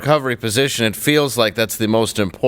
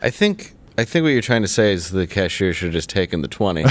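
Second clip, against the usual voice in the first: ordinary delivery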